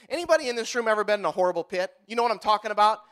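ordinary delivery

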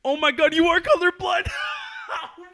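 high, loud shocked outburst; shocked shout. Real, not acted. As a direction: yell it in disbelief. Shocked laughing at colorblind friend.

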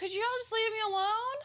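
high-pitched exasperated plea; pestered goat man. Acted. Goat man pleading with visitors on his bridge, mock exasperation. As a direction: whine it like a creature who wants peace.

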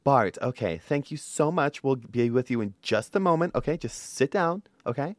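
quiet, polite front-desk receptionist; soft receptionist voice. Acted. Receptionist softly telling patient Bart to wait. Improvised dentist receptionist role.

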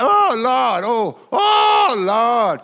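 high ecstatic shout of "oh, Lord"; ecstatic cry. Retold or imitated. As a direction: cry out to the Lord as the spirit hits. Woman struck, crying out.

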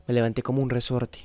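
plain reading voice

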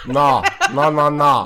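loud, high sing-song refusal; loud playful no. Pretend. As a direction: shout the na na teasingly. (laut und neckisch abwehrend)

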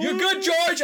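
high-pitched calling out; retold yelling. Canvasser calling after a student, high mock yell. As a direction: imitate a stranger hollering reassurance after someone. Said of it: "he kept yelling".

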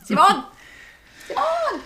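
high, hammed-up Spanish-accented "see-MON!"; exaggerated Spanish Simon, rising into a high cry. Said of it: "try to say it with the accent".

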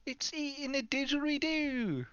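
high, drawn-out cartoon Australian accent; exaggerated Aussie, every vowel stretched out; mock a broad Australian accent at a high pitch. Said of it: "Real life Australian accents".

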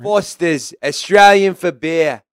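put-on a slow, light Australian accent, like a beer ad. Host imitating an Aussie beer ad for Australian viewers, mild Australian accent. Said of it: "that was a good accent".

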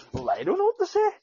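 squeaky mocking take on a clueless mate; mock helpless friend. Imitating friend's helpless reply.

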